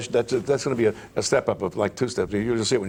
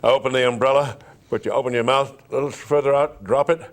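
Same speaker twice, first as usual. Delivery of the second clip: ordinary talk, nothing marked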